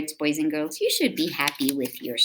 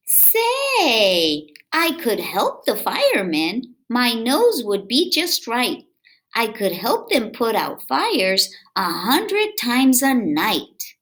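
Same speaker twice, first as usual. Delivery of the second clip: lively, sing-song read-aloud voice; reading to children; 7 semitones higher, 7 dB louder, slower than usual. A narrator's reading. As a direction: read it to little kids with bounce. Reading picture book aloud.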